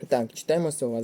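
ordinary delivery

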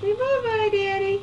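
high sing-song bye-bye; baby talk. Pretend. Speaking for baby to dad.

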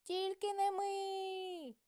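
high, slow squeaky mouse cry; lazy mice refusing, squeaky and high; mice shouting. A narrator's reading. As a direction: squeal it out, drawn out and stubborn. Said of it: "а мишенята й знов своє".

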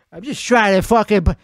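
ordinary talk, nothing marked

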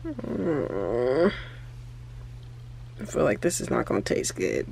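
usual speaking voice

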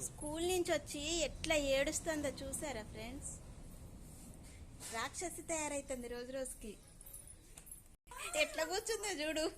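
normal talking voice

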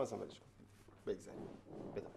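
soft, fading brush-off; trailing off quietly. Real, not acted. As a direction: let اصلاً ولش کن die away under the breath. Backing out of the offer, trailing off.

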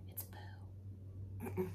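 unvoiced cheeky whisper. Real, not acted. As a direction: whisper the naughty word. Naughty word told to kids.

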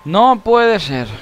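high, very slow drawn-out wail; slow moaning can't be; stunned disbelief. Real, not acted. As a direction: stretch it out in dismay. Disbelief at game.

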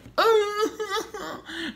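very high fake crying wail, half laugh; mock sobbing wail. Mocking a rival YouTuber's tears with a fake high wail. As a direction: wail like a crybaby to mock him. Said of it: "You act like a little girl when you cry".

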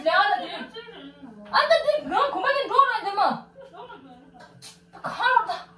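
high-pitched giggly talk; high laughing. Real, not acted. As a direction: laugh through the words, voice way up. Laughing high-pitched chatter about voice. (높은 목소리로 웃으며)

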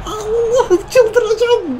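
high, slow, crying wail of despair; anguished wail. Real, not acted. Gamer wailing at his own missed chance, high and crying. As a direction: drag it out like you're losing your mind.

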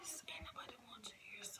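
breathy aside whisper. Real, not acted. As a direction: whisper it behind her.

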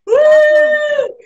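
high, loud "woo" cheer; loud rallying workout whoop; excited whoop. Real, not acted. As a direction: let out a long high whoop.

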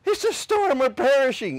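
high, loud hammed-up fear; mock panic. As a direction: cry out over the storm. Disciples panicking in storm.

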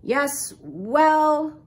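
grudging, grumbly uncle; grumbling. A narrator's reading. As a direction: grumble a reluctant concession. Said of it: "grumbled Uncle Phil".

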